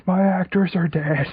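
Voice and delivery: high, slow, loud pretend lament; mock wailing. Joining a friend's grief over dead actors, mock wailing. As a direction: draw out a hammed-up wail.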